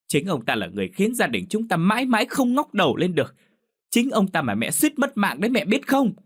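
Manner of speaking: very high, desperate yell; helpless shout. A narrator's reading. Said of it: "Lam bất lực hét lên".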